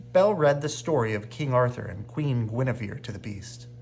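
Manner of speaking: plain reading voice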